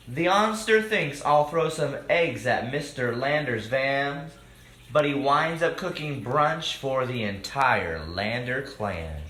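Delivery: lively rhyming storybook read-aloud; reading to children. A narrator's reading. Host reading a picture book to children, lively. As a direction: read the rhymes out to the kids.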